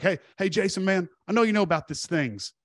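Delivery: coworker voice. Retold or imitated. Coworker voiced approaching Jason.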